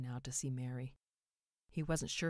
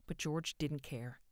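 plain reading voice

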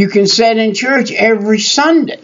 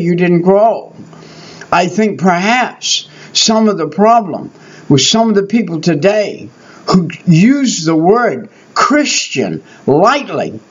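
ordinary delivery

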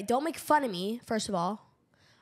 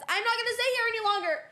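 emphatic refusal; 9 semitones higher, faster than usual. Real, not acted. Refuses to stay in seat.

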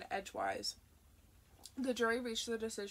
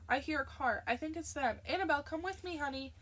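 excited; 6 semitones higher, 4 dB louder than usual. A narrator's reading. Sherry hears the family arriving.

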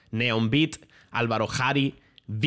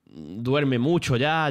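slowed, mimicking old dog; 2 semitones higher, much slower than usual. Describing old dog slowing down.

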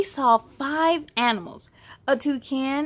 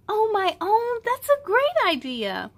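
put-on cheerful kid voice; 10 semitones higher than usual. Character reply.

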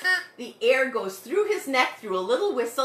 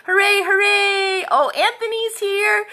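high, loud cheer; excited welcome; 7 semitones higher, 7 dB louder than usual. Real, not acted. Host greeting children as they join, high loud cheering. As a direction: celebrate who just turned up.

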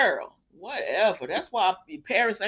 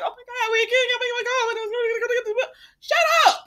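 mock panic; 14 semitones higher, 5 dB louder than usual. Mocking Hope's panic.

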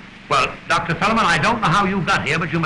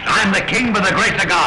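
proud retort; 4 semitones higher, 4 dB louder than usual. Acted. King asserts his authority.

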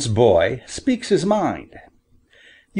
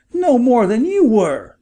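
sharp retort; 10 semitones higher, 3 dB louder than usual. A narrator's reading. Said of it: "retorted Farmer".

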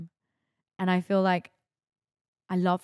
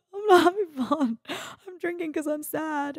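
high, louder crying protest; louder mock sob; 11 semitones higher, 3 dB louder than usual. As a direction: wail it, half-laughing at herself. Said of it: "And I was like".

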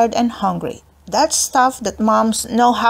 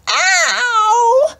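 much higher, crying yelp; little sister yelling; 13 semitones higher than usual. A narrator's reading. Little sister hit on the knee, crying out. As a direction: yelp like a hurt little girl. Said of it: "Now she really yelled".

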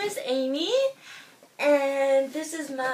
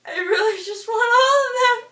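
loud sobbing wail, much louder; 6 semitones higher, 6 dB louder than usual. Real, not acted. Vlogger wailing her love for all cats, loud sobs. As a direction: break down completely, wailing the words out. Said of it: "I promised myself I wouldn't cry".

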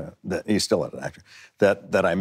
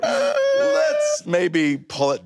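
sheepish backing off; 8 semitones higher, much slower than usual. Retold or imitated. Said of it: "I was like".

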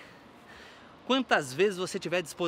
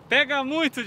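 loud; 7 semitones higher, 6 dB louder than usual. Real, not acted. Exhausted after the workout round.

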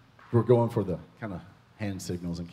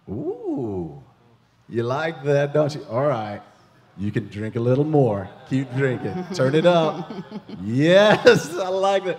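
rising, louder, laughing delight; higher and louder with laughter, cheering the sip on; excited; 4 semitones higher, 8 dB louder than usual. Real, not acted. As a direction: get swept up in the joy. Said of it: "I get a little excited".

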